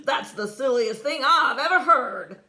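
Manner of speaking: loud, mocking laughter through the words; laughing. A narrator's reading. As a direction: scoff and laugh at the idea. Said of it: "the shiny man laughed".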